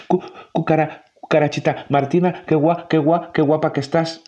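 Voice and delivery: high, stammering nervous mouse; a shy mouse tongue-tied in front of the cockroach he likes. A narrator's reading. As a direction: trip over every word, flustered. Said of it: "se le escaparon las palabras".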